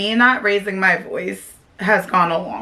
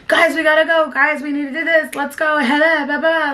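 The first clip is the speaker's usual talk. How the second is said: imitating yelling at kids; 8 semitones higher than usual. Mother hurrying her kids out the door, mock yell. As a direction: hurry them out of the house, calling high. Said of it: "the yelling portion of my child".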